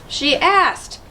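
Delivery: high squeal. A narrator's reading. Little sister squealing her defence to brother. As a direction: squeak it out in protest. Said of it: "the squid squealed".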